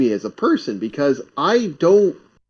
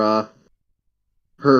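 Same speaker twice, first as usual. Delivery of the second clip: usual speaking voice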